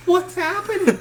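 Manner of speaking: high, bewildered yelp; a ten-year-old caught off guard, squeaking in confusion; baffled squeak. Retold or imitated. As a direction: sound totally lost and alarmed. Boy baffled by friend's orders.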